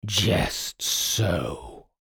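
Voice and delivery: measured, deep spirit voice; solemn djinn, drawn-out words; deep-voiced djinn. A narrator's reading. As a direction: answer gravely as the djinn. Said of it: "the blue fellow replied in a deep voice".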